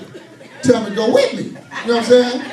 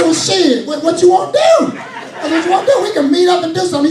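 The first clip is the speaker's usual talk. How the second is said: mock tough challenge; 7 semitones higher, 3 dB louder than usual. Challenging him to meet.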